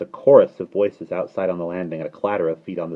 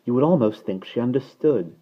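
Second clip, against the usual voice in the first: higher, gushing admiration; delighted grown-up fussing; admiring cry; 6 semitones higher, 4 dB louder than usual. A narrator's reading. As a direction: coo over a clever baby. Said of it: "they would cry".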